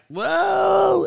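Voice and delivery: high, sudden mock surprise; a retold high startled whoa. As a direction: jump up in pitch like you've been spooked. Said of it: "And you're like".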